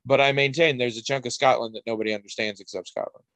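normal talking voice

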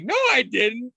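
put-on high little-kid voice insisting; imitating a small boy protesting; kid's denial. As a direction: squeak out the denial like a young boy. Kid denying in story.

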